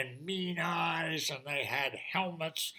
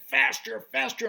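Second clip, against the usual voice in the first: high, louder urging; urging fairy; 7 semitones higher, 6 dB louder than usual. Acted. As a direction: hurry him on. Fairy urges.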